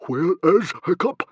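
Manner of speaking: high, loud frantic question; urgent demand. A narrator's reading. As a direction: demand to know where your son is. Father desperately seeks missing son.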